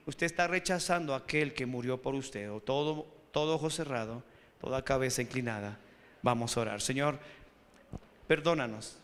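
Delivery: low, softened call to bow and pray; hushed altar-call prayer, voice dropped low; leading into prayer. Real, not acted.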